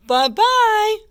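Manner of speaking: high, singsong farewell call; mama bear calling after someone; middle-sized bear voice. A narrator's reading. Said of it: "a middle-sized voice".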